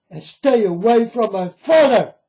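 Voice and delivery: angry shout. Real, not acted. Warning deceivers off his father, loud and angry. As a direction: shout the last warning at them.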